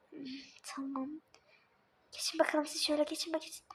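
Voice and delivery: fast hushed whisper while hiding. Acted. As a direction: whisper so the seeker can't hear. Hiding from the seeker. (saklanırken hızlı fısıltı)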